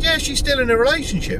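high, mock-knowing reply; mocking friend. Mimicking the friend explaining the Facebook status, high. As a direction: ape the mate's smug tone.

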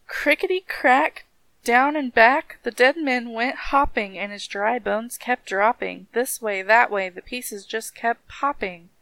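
lilting storybook reading; rhythmic story narration. A narrator's reading. Reading how the dead man danced, rhythmic story narration. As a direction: let the list of rattling parts roll along.

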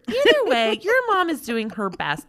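normal talking voice

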